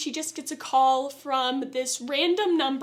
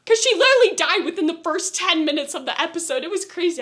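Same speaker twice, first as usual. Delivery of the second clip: ordinary delivery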